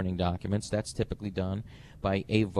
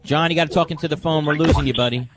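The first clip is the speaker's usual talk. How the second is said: loud; 6 semitones higher, 7 dB louder, faster than usual. Real, not acted. Host calls to a fading caller.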